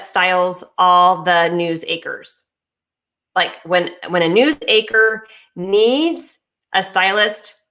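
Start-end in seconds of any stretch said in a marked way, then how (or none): none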